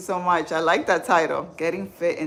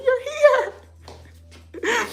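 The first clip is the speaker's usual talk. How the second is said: excited greeting; 6 dB louder than usual. Retold or imitated. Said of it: "I was like".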